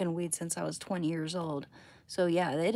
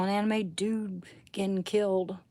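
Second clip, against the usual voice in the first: ordinary talk, nothing marked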